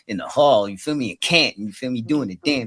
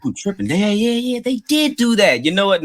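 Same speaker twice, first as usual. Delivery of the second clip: high, louder, excited concession; sudden realisation; 12 semitones higher, 5 dB louder than usual. Real, not acted. Conceding the Ranger story point to co-host, excited realisation. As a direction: catch yourself mid-argument, excited.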